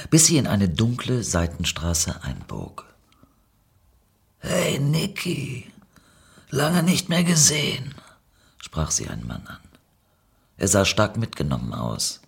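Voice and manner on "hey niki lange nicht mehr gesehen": gedehnte, höhere Stimme eines mitgenommenen Dealers; abgewrackter Dealer. A narrator's reading. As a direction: die Begrüßung langsam in die Länge ziehen. Abgewrackter Dealer spricht Niki an. (verlebter Dealer, schleppend und hoch)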